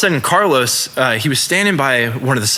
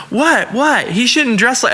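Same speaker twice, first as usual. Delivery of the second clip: higher-pitched imitation of an indignant woman; freaking-out granny; 7 semitones higher, slower than usual. Old woman pushed out by the bouncer, imitated freaking out. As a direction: mimic her protest in a raised voice. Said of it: "she's freaking out".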